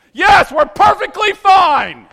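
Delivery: loud high mock-cheerful reply, slow; sarcastic shout. Stuck driver answering the truck guy, loud sarcastic fine. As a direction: sarcastically insist all is fine.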